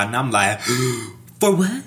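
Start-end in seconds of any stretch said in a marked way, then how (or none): 0.6-1.1 acted gasp of surprise; mock shocked gasp. Retold shock at jail news. 1.4-1.8 high, loud, astonished question; retold shock. Retold shock at jail news.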